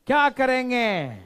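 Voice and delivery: loud, high, stretched-out demand; shouted question. Real, not acted. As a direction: shout it to rouse the class. Repeats question to unresponsive class. (ज़ोर से चिल्लाकर सवाल)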